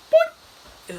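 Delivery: high, loud cartoon boing. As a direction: squeak a Three Stooges boing. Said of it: "the whole Three Stooges".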